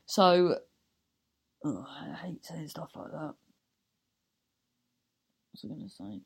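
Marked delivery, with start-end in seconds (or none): 1.7-6.2 unvoiced, much softer self-conscious aside; embarrassed whisper. Real, not acted. Host cringing at her own plug, whispering. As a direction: hide the words in a cringing whisper.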